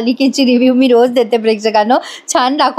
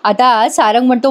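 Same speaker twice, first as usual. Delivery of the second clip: ordinary delivery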